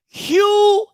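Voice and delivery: high, emphatic, drawn-out telling-off; comic scolding. Pretend. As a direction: scold him in mock exasperation. Comic scolding of Hugh over suits.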